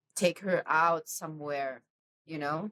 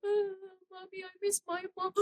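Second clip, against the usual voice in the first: mock sob, much higher and softer, half sung; imitated sobbing; 14 semitones higher, 4 dB quieter than usual. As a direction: wail it like a sobbing child, but lightly. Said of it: "kayak sesunggukan gitu yang kayak".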